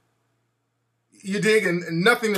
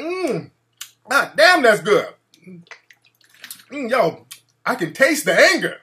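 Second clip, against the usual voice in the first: mock savouring; 4 semitones higher, 3 dB louder than usual. Pretend tasting troll tears.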